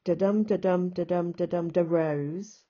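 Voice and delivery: brisk "da dum" chant landing on long "rose"; weak "da", strong "dum", up to "rose"; iambic rhythm. Shown as a demonstration. Teacher sketching second rhymed line, iambic rhythm. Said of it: "like this and say".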